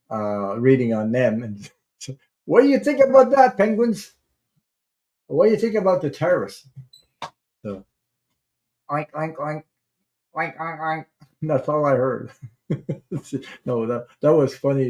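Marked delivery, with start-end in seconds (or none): 2.4-6.7 squeaky high voice asking the penguins; mock penguin voice. Vlogger teasing penguins in a high put-on voice. 8.9-11.0 mock oinks. Imitating penguin noises.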